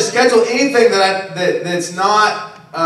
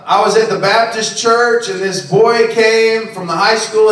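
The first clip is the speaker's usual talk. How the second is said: ordinary talk, nothing marked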